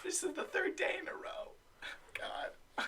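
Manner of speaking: despairing whispered aside; whispering. Pretend. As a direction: whisper it in dismay. Fired employee whispers aside.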